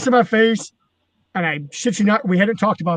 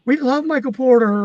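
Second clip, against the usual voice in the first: small, raised mock-kid voice; kids' voices; 6 semitones higher than usual. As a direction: imitate pleading young fans in a small, high voice. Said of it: "kids would come up".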